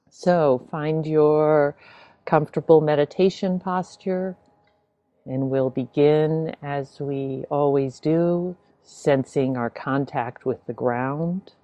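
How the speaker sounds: unhurried meditation guidance with long pauses; spacious, measured instructions, leaving silence between phrases; guided meditation. Real, not acted. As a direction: ease listeners gently into the practice. Begins leading a guided meditation.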